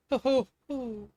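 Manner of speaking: slow hooting owl call. Acted. Lightly voiced owl hooting at the prince. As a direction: hoot it slowly from the branch. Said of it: "didn't sound like the person he was expecting".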